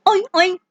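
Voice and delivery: two high oinks; pig oinking. Shown as a demonstration. Teacher showing what a pig says, oinking high. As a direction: oink like a pig. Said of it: "A pig says".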